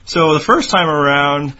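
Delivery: ordinary delivery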